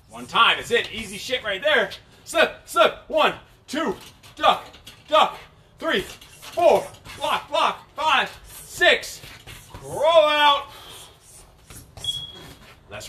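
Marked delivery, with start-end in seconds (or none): 2.3-10.7 boxing coach calling combos. Real, not acted. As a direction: shout the combo to the class.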